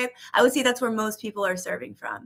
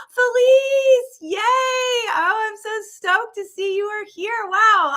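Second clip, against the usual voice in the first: gasping, much higher, louder squeal of delight; thrilled greeting; 12 semitones higher, 5 dB louder, slower than usual. Real, not acted. Host spotting a friend in the chat, squealing with delight. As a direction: greet her like a long-lost friend.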